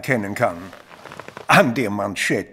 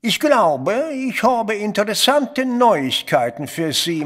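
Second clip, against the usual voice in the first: ordinary talk, nothing marked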